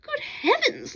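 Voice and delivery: a sudden flash of recognition; surprised exclamation. Acted. Lawrence suddenly recalling an old soldier, surprised exclaim. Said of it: "Lawrence exclaimed".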